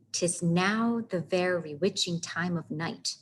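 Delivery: slow "'Tis now the very witching time of night", every second syllable leaned on; exaggerated iambic beat. Shown as a demonstration. Teacher showing the meter of Hamlet's line, exaggerated slow beat. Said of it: "you would not say it like that".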